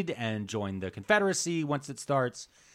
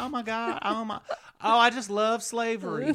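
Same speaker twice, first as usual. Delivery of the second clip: put-on high, gushing Southern belle accent, laughing through it; Southern lady; 10 semitones higher than usual. Spy charming Baltimore secessionists in a high Southern belle accent. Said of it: "She's pretending to be a Southern lady".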